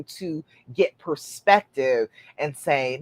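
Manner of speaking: normal talking voice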